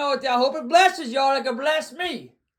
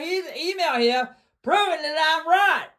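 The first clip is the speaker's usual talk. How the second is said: ordinary talk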